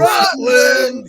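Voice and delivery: very loud, high holler; announcer's shout. Acted. Joining the Avengers' big entrance, hammed-up shout. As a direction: bellow it to the rafters.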